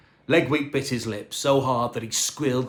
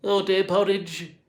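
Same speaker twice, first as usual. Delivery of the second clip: quivering voice; 6 semitones higher than usual. A narrator's reading. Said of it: "Dave said with a quiver".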